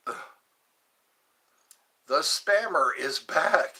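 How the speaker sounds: very slow, drawn-out warning; slow, ominous mock announcement; dramatic announcement. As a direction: announce the villain's return. Announcing the spammer slowly.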